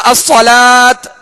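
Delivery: slow الصلاۃ ending in a bare t; wrong t stop. Shown as a demonstration. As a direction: say الصلاۃ as "as-salaat". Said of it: "تا پڑھ کے نہیں".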